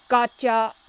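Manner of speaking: loud triumphant robot shout. A narrator's reading. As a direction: bark it as you tag him. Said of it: "shouts Robo Pete".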